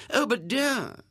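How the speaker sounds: high-pitched motherly protest. A narrator's reading. Missus Lane begging her guest to stay, high protest. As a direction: object sweetly, pitched well up. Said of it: "protested Missus Lane".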